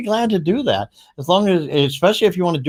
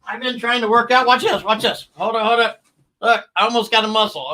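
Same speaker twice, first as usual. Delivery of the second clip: higher, effortful lifting voice; straining with weights; 6 semitones higher than usual. Pretend. Host showing off with weights to viewers, strained and high. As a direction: talk through a hammed-up muscle strain. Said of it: "Okay, now I can't breathe".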